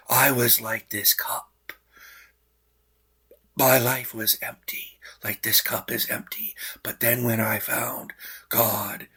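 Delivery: hushed, earnest testimony voice; quiet and sincere; soft earnest voice. Retold or imitated. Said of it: "And he's like".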